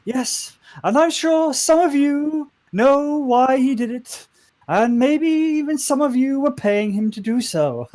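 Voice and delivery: high, slow, loud character voice; positive, happy speechmaker. Acted. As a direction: address the room brightly in a high accented lilt. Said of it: "she's still very positive, very happy".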